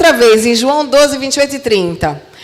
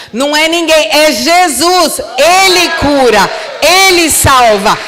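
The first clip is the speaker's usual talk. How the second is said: loud, slow declarations; building shout of faith; climactic preaching; 3 semitones higher, 3 dB louder than usual. Real, not acted. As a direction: proclaim each line to the crowd.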